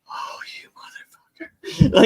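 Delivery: slow, breathless whisper pitched high; whispered mock exasperation; imitated whisper. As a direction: hiss it in shocked disbelief. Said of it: "he's like".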